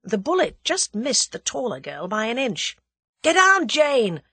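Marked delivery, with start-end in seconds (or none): 3.2-4.2 loud, high drawn-out warning; urgent cry. A narrator's reading. Julius warns the girl as shots fly, crying out. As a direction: cry out a slow, forceful command. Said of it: "cried Julius".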